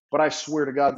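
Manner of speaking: ordinary talk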